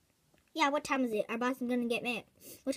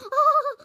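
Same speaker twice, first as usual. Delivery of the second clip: short high burst of laughter; 13 semitones higher, 8 dB louder than usual. Acted. Laughing at the neighbour for making a shop. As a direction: crack up loudly and high. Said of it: "why are you laughing at me".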